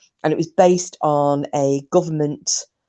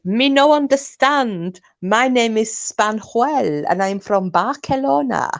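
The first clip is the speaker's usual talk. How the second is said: hammed-up fake Spanish accent, higher and slow; Manuel's appalling Spanish accent; 6 semitones higher, slower than usual. Owner voicing the spaniel as Manuel, bad Spanish accent. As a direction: put on a terrible, drawn-out Spanish accent in a higher pitch. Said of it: "that was the most appalling accent".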